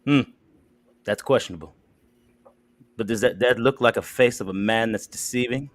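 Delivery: low, slow, seductive host read; smooth dating-show pitch. Acted. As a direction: sell him like a late-night dating ad.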